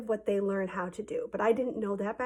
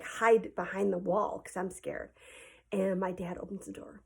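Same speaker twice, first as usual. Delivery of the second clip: ordinary delivery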